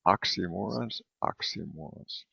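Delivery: oxymorons murmured softly to a neighbor; quiet practice. Shown as a demonstration. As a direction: say oxymorons under your breath, twice. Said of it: "Say oxymorons to your neighbor twice".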